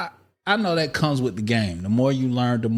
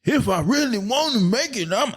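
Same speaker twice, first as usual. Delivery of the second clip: high put-on voice; 12 semitones higher than usual. Acted self quote.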